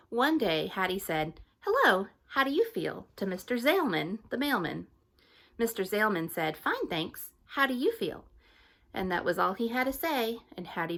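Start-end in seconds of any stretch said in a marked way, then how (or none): 1.6-3.1 high, louder eager greeting; Hattie's voice. Acted. Hattie greeting Mister Zalman, high and eager. 6.6-8.3 higher, friendly reply; mailman's voice. Acted. Mister Zalman the mailman answering Hattie, higher and friendly.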